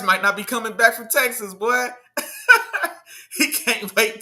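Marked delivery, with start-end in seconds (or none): 2.1-2.9 sharp high laugh; quick high cackle. Real, not acted. Laughs at own Rashee Rice joke. 3.4-4.2 slow, still laughing through the words; words squeezed out mid-laugh. Real, not acted.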